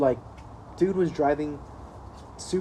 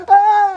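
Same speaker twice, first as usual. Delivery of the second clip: mock startled yelp; 13 dB louder than usual. Friend startled again, car swerving.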